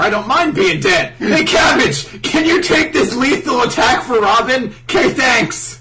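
high, loud, breezy mock shout; calling out. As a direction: holler a cheeky favour across a battlefield. Acting Bartolomeo calling to Cabbage.

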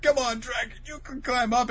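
imitated eager encouragement; rooting. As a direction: egg the little dragon on. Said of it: "they're, like, rooting for it".

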